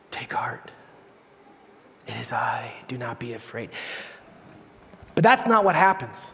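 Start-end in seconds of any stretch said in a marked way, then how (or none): 0.1-3.7 soft, low near-whisper; calm, tender, compassionate. Acted. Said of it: "very calm and tender, compassionate voice".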